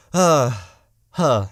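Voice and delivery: breathless; short of breath. Real, not acted. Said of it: "I feel like I'm getting out of breath now".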